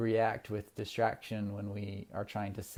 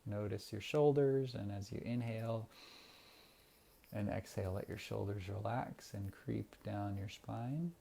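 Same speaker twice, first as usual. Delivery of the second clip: guided meditation. Acted. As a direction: ease the listeners into calm breathing. Leads a breathing meditation.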